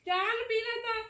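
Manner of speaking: put-on high, sing-song coaxing to a toddler; baby talk. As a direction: coax the little one gently. Mother coaxing her little child. (ਬੱਚੇ ਨਾਲ ਤੋਤਲੀ ਲਾਡ ਭਰੀ ਬੋਲੀ)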